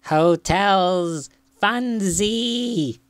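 high, drawn-out happy fly; high-pitched insect voice; buzzing fly voice. A narrator's reading. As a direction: talk like a fly, buzzing the z sounds. Fly's buzzy closing remark.

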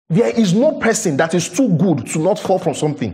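ordinary talk, nothing marked

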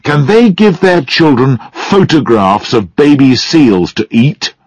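high-pitched, indignant tirade; angry rebuke. A narrator's reading. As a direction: fire scornful questions at the daughter. Father angrily rebukes his daughter.

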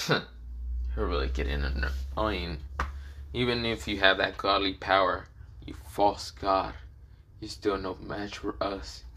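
slow, low and soft villain; quiet contempt. Acted. Dark Raikou scorning the false god, slow quiet contempt.

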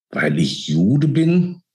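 slow, high, stretched-out disbelieving repeat; drawn out. A narrator's reading. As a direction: stretch each word out in shock, voice lifted. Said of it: "wiederholte er gedehnt".

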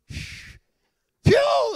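high, loud shouted phew; loud relieved whoosh. Retold or imitated. Driver escaping a truck, shouted high phew.

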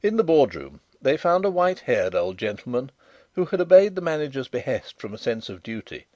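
ordinary delivery, nothing marked

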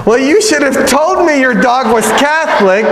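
high, laughing priest voice; priest's punchline. Acted. Priest answering the rich widow, high and amused.

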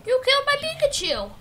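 high, loud shocked cry; real dismay at losing the catch; anguished outcry. Real, not acted. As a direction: yell it as your Pikachu goes down. Pokémon knocked out mid-battle.

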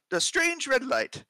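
put-on slipping into an Australian accent. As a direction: read it like an Aussie sailor. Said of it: "I just went into Australian".

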